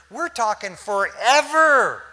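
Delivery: "forever" stretched out, loud and high; emphatic FOREVER. Real, not acted. Preacher telling church of eternity, "forever" loud and drawn out. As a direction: belt out "forever" slowly to drive it home.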